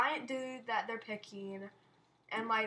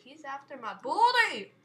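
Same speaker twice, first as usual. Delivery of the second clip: loud cry rising into booty; mock-alarmed yell; 2 semitones higher, 13 dB louder than usual. Gamer chased by the giant, mock-alarmed yell. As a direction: playfully yell for help.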